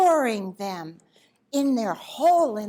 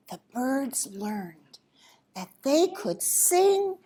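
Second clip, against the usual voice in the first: ordinary delivery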